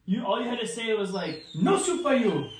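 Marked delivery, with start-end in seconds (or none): 1.5-2.5 high-pitched catchphrase impression; sitcom catchphrase. Player shows what partner could've said, catchphrase voice. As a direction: bark out a famous sitcom refusal. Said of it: "Jerry Seinfeld".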